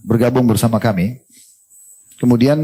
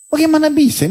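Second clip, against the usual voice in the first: much higher, incredulous outcry; disbelief; 13 semitones higher than usual. Real, not acted. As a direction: throw up the question in astonishment. Preacher's rhetorical disbelief. (heran, jauh lebih tinggi)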